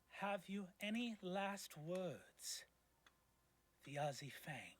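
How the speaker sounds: a lightly voiced cold executioner, drawn out; executioner's call. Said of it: "you hear the voice from the dais say".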